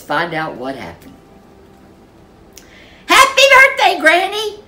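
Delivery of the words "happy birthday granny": high, loud birthday shout; a child bursting in with a happy yell; excited yell. Acted. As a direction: call out to Granny, thrilled and loud. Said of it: "yelled Lil Red".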